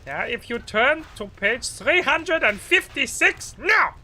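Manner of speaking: high, loud German-ish lecturer barking; loud mock lecturer, slow then yelling; shouting lecturer. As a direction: snap at the class like a stern lecturer. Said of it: "sounding like that".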